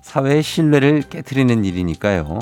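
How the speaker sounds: usual speaking voice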